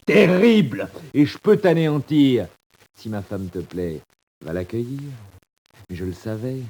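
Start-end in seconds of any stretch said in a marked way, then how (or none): 0.1-2.5 « Terrible » crié haut et fort, lent; écrase-le de ta menace; menace criée. Acted. Menace Maurice en criant. (menace hurlée, plus lente)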